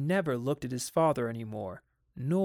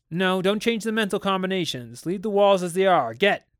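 louder, higher commanding voice; father sending the children out of the nursery, louder; stern order; 5 semitones higher, 7 dB louder than usual. Acted. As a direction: drive the children out firmly.

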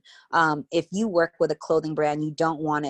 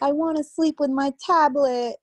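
put-on higher, pleading toddler; little girl voice; 10 semitones higher, 3 dB louder than usual. Daughter asking mom for her tablet, high childlike plea. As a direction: ask like a small child begging. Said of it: "she's like".

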